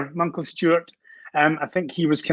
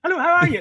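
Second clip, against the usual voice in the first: high sing-song rising lilt on each word; sing-song French-Canadian lilt on "Hello, how are you?"; upward inflection; 13 semitones higher, faster than usual. Shown as a demonstration. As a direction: lift the line up, Montreal joual rhythm. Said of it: "It's an upward inflection".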